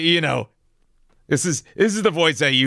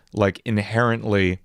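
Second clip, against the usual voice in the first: ordinary talk, nothing marked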